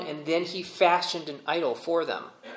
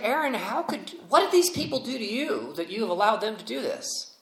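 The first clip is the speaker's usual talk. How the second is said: exasperated Moses; 7 semitones higher than usual. Acted. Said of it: "He's like".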